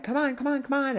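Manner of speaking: high sing-song coaxing call; coaxing a dog. Retold or imitated. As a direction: coax the dog back lightly. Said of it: "she's like".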